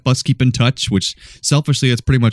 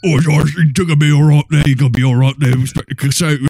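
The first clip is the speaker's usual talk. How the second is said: loud mumbled mock Clouseau accent, louder; garbled mock accent; 5 semitones higher, 4 dB louder than usual. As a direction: slur the words through a thick put-on French accent. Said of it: "I can't understand anything you're saying".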